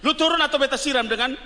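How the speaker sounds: hammed-up angry older sibling; mock angry threat. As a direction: threaten the late sleeper, half joking. Acted angry threat to sleeper.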